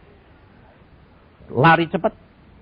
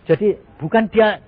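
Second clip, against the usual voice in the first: ordinary talk, nothing marked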